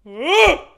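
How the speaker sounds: short high yelp of fright; jump-scare cry; startled yelp. Real, not acted. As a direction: yelp as it rises sharply. Startled in horror game.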